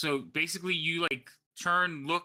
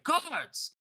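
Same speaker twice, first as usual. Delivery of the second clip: calling guards; 13 semitones higher than usual. Acted.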